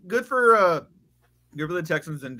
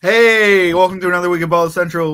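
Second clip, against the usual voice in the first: show intro; 3 semitones higher, 10 dB louder than usual. Real, not acted. Host put on the spot to open the podcast, loud show intro. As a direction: kick off the show with a big loud greeting. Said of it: "Go ahead, do it".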